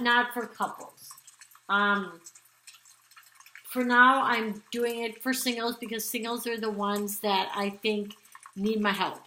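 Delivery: ordinary delivery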